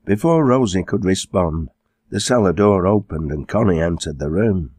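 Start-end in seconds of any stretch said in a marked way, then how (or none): none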